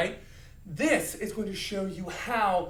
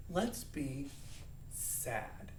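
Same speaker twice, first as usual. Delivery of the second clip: soft, low, subdued; sad; 4 semitones lower, 11 dB quieter, much slower than usual. Shown as a demonstration. Teacher introducing the next emotion, sad. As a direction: let it sink to a near murmur.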